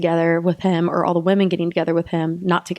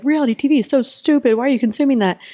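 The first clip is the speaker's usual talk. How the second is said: higher-pitched mock disapproval; pitched-up imitation of a naysayer; imitating critics; 6 semitones higher, faster than usual. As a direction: scoff like a reality TV snob. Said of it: "people are like".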